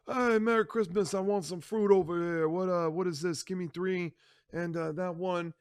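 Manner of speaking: a lightly voiced old Don; Don's voice. The Don ordering fruit from the merchant, lightly voiced.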